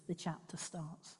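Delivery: normal talking voice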